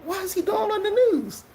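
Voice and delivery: high, long stunned question; seeing the pastor on TV, stunned and high. Retold or imitated. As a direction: stretch it out in disbelief. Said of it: "I was like".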